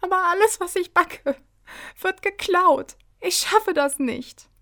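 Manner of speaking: high, gasping, tearful wail; acted sobbing. A narrator's reading. Angelina despairs over her stolen baking, sobbing. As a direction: break the sentence up with sobs. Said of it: "zwischen ihren Schluchzern".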